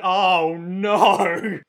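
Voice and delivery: loud dismayed "oh no"; villain dismay. Retold or imitated. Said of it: "Kira's like".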